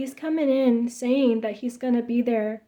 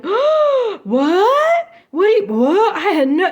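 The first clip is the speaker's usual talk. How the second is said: much higher, louder mock shock; fake surprise; 10 semitones higher, 10 dB louder, slower than usual. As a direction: play dumb, feigning total surprise. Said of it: "totally play it off".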